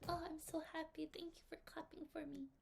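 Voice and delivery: soft, breathless whisper of thanks; shy thanks. Pretend. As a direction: whisper your thanks, bashful and touched. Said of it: "be like".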